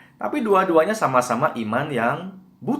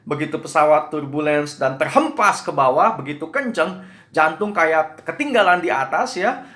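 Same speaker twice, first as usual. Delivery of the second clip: ordinary talk, nothing marked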